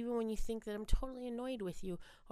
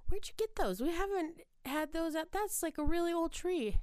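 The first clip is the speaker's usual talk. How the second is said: higher-pitched retold disbelief; surprised owner, imitated; 7 semitones higher than usual. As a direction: act out a puzzled, surprised question. Acting out owner's surprise at lights.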